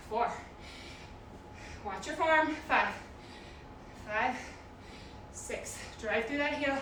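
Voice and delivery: ordinary delivery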